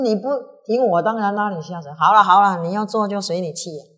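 loud, high quarrel; angry retort. Acted. Retainer quarreling with his friend, angry retort. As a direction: throw the blame back in his face.

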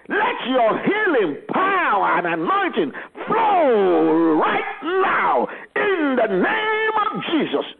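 high, slow crying shout; shouting faith healer. Acted. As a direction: cry it out to heaven, drawn out. Healing prayer climax.

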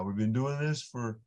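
ordinary delivery

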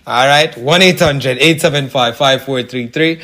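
ordinary talk

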